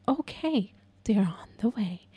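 hushed, soothing dispatcher impression; nearly whispered mock-calm reassurance; overly calm operator. Operator reassures a desperate caller, mock calm and hushed. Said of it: "why they speak like that".

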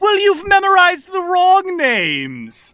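high, slow, drawn-out taunt; triumphant little tricksters; gloating gnomes. Acted. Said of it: "and they say".

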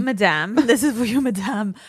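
lightly voiced posh waiter; hammed-up waiter delivery; fancy waiter. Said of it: "he has to say madame".

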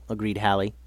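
usual speaking voice

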